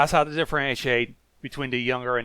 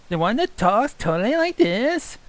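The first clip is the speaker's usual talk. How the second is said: put-on higher, bubbly California Valley Girl accent; a hint of Valley Girl accent, totally; 10 semitones higher, 5 dB louder than usual. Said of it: "the California Valley Girl accent".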